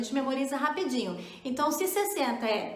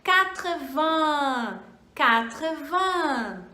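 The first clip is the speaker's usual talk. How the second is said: "quatre-vingts" said slowly as "katr-van"; slow model; 4 dB louder, much slower than usual. Shown as a demonstration. Teacher slowly models eighty for learners. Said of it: "O oitenta, então, vai ser".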